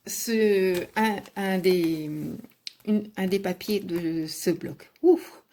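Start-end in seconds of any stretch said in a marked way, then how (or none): 5.0-5.4 ouf de soulagement. Real, not acted. Souffle après un effort.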